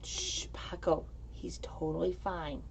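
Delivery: soft whisper so as not to wake them. Real, not acted. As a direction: whisper it to keep things calm. Shushing pet, kids asleep.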